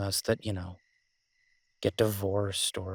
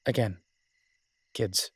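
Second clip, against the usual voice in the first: usual speaking voice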